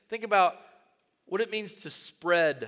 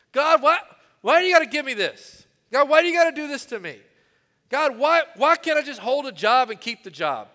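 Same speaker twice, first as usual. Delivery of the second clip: loud, higher, fast whiny complaining to God; mock self-pitying whine, pitched up and pushed loud; pathetic whining; 6 semitones higher, 7 dB louder than usual. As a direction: whine at God like a spoiled child, louder and faster. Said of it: "all my own pathetic whining".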